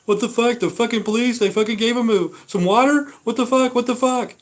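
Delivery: high, loud, outraged mock rant; outraged woman imitated. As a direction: mimic a woman losing it over the water. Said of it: "she's going berserk".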